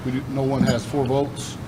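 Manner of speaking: ordinary talk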